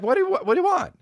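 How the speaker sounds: mock disbelief. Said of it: "I'm like".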